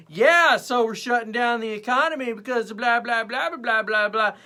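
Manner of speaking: high, loud mock protest; mocking idiot Twitter. Twitter user protests the economy shutdown, loud mocking voice. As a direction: rant in a high put-on voice. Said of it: "idiot Twitter was just like".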